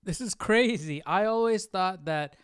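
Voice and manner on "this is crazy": usual speaking voice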